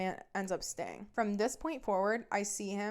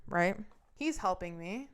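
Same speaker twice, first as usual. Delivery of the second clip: ordinary delivery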